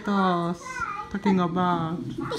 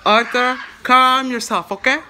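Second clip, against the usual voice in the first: louder, telling off a little cousin; raised voice scolding a little cousin; calling out; 3 semitones higher, 7 dB louder than usual. Real, not acted. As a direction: call his name out loud to settle him down.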